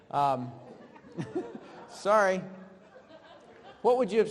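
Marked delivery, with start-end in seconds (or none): 1.1-1.9 breathy unvoiced giggle; an airy chuckle; quick silent laugh; soft laugh. Real, not acted. Teacher laughs at own slip.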